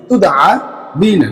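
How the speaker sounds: তুদআবিনা stretched out very slowly; slow demo. Shown as a demonstration. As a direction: enunciate তুদআবিনা syllable by syllable. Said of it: "teacher demonstrating the word".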